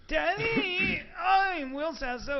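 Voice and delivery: high, shouted, slow mock moan. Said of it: "imitating Will".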